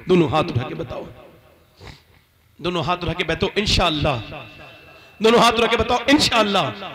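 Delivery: loud, building call to the crowd; rallying call. Real, not acted. Rallying audience to raise hands. (مجمع کو بلند آواز میں للکارنا)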